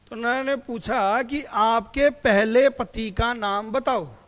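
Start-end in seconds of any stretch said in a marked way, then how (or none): 1.4-4.1 loud, slow emcee on the mic; big, drawn-out stage question; anchor announcing. Retold or imitated. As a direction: announce it slowly to the whole hall. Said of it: "उसने mic पे announcement किया".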